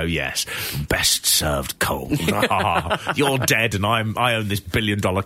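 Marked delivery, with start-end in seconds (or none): 0.0-2.2 smug villain voice. Retold or imitated. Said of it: "He must look back on that now and just go".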